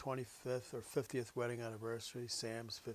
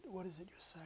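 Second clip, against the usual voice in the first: hushed whisper; 4 semitones higher, 6 dB quieter than usual. Retold or imitated. Husband asks softly.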